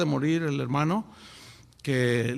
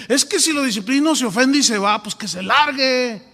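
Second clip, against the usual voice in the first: louder and higher, dismissive; a pastor done with the sinner, slowed at the ends; mock fed-up pastor; 5 semitones higher, 7 dB louder than usual.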